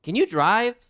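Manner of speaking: high, loud disbelieving ask; imitating someone amazed a deaf person can drive; incredulous question. Strangers asking a deaf woman in disbelief, high and loud. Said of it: "People ask me".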